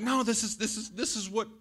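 high-pitched mock protest; hammed-up high, stammering defence; protesting dad. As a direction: plead your case in a high voice. Acts himself defending the rough game.

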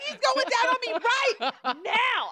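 high, loud giggly imitation; mock girlfriend. Girlfriend yelling details to the street, high laughing mock voice. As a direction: lightly squeal it through laughter. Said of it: "She was like".

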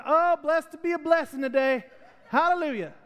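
high-pitched, hammed-up church cheer; mock blissful. Imagined Jesus on the cross, absurdly cheerful. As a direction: exult in absurdly fake joy, pitch way up.

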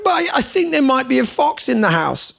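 put-on be the anxious kid who heard a noise; child fretting to Dad about a fox in the house; worried child. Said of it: "they'd say something like".

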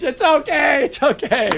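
mock comforting. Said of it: "waiting for someone to run up on stage".

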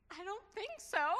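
high, loud tearful wail, retold; loud, high teary protest; imitating sobbing daughter. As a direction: mimic the daughter crying out her refusal.

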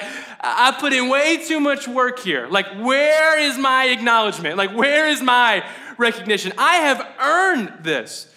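hammed-up aggrieved outburst; indignant entitlement. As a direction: demand credit in an acted-out protest. Acted mentality of the entitled worker.

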